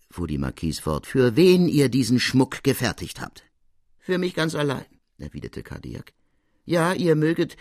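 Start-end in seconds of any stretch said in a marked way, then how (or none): 1.1-3.4 louder, higher insistent question; drängende Marquise. A narrator's reading. Marquise drängt Cardillac zur Antwort. 4.0-4.9 high-pitched character voice; Cardillac in character. A narrator's reading. Cardillac antwortet der Marquise. 6.7-7.6 raised, high goldsmith's voice; Cardillac in character. A narrator's reading. Cardillac fährt fort.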